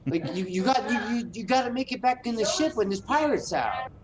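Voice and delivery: high, breathless Boston voice; Mark Wahlberg. Acted. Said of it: "You gotta sound like Mark Wahlberg, like, out of breath all the time".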